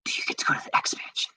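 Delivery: very quick, hushed whisper; whispered self-question. Real, not acted. Gamer asks himself if the X Mansion is visitable, whispering. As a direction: whisper it fast under your breath. Asking himself while trying to recall.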